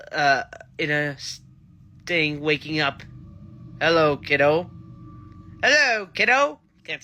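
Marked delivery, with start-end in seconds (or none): 3.8-4.7 a half-hearted evil greeting, a little louder; menacing villain greeting, lightly played; mock menacing. Shown as a demonstration. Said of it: "saying it in such a menacing, evil way". 5.6-6.6 higher and a little louder, trying for cheerful; a forced cheerful greeting that still sounds menacing; attempted cheerful. Shown as a demonstration. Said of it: "nice, calm, cheerful way".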